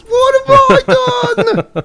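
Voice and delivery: very high, slow mock wail with a laugh; imitating drunk wail. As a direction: mimic her whining cry. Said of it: "She's, like, just getting in their faces".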